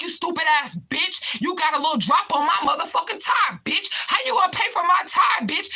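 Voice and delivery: high, loud shouted imitation; furious berating. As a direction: imitate him screaming abuse at a waitress. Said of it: "this is how he was talking to her".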